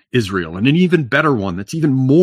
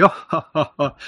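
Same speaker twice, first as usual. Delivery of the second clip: polite fake laugh; 7 semitones higher than usual.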